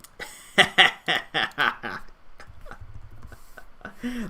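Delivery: real, high burst of laughter; helpless laughter. Real, not acted. Streamer laughs at a thumbnail, cracking up. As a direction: crack up and let it tail off.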